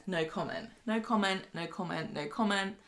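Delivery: flat repeated refusal. Retold or imitated. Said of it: "He says".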